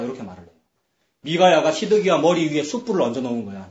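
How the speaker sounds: usual speaking voice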